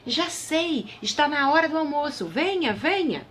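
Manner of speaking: voz aguda de rainha animada. A narrator's reading. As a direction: chame animada para o almoço. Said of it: "A rainha falou". (rainha chamando para o almoço)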